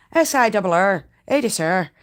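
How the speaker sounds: put-on spells it out in a playful Irish accent. Spinner showing chat her Irish, a mock Irish accent. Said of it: "That, that's Irish".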